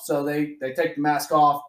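ordinary talk

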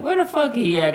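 high-pitched irate granny voice; imitated granny, high and testy. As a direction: demand it as a fed-up grandmother. Granny demanding where he is.

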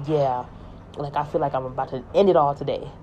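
ordinary delivery

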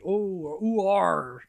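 broad West Country farmer "Oh, ooh, are."; a rustic Devon farmer; Devon farmer accent. Retold or imitated. Mimicking Devon farmers in a broad Devon accent. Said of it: "farmer country".

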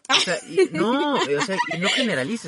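high, loud laughing objection; laughing protest. Real, not acted. Host objecting to his co-host's claim that men lie, laughing loudly.

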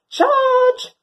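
high, loud charging cry; dog charging a seagull; battle cry. Acted. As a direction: yell it as you launch.

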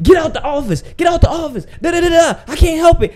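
high, shouted, shooing voice; a Mali airline clerk throws him out, high mock shout; mimicking rude clerk. Mimicking the rude Air France lady.

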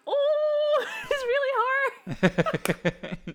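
high, loud fangirl squeal, imitated; starstruck squeal. Fan retelling seeing the star appear, mock squealing. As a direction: re-enact a swooning fan, drawn out and cracking up. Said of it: "we were just like".

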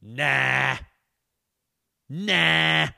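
a curt, loud "Nah", said twice; a clipped, dismissive double "Nah"; dismissive retort. Retold or imitated. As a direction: brush him off right in his face. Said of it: "I go".

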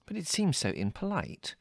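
soft, high-pitched motherly fretting; worried. A narrator's reading. Mother fearing to offend the guest, worried. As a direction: fret gently in a high voice. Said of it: "said Mother worriedly".

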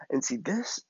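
ordinary delivery, nothing marked

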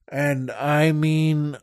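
usual speaking voice

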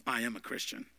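quiet conviction. Retold or imitated. Pastor imagining old man before crowd, quiet. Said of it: "Imagine this eighty-six-year-old man ... saying".

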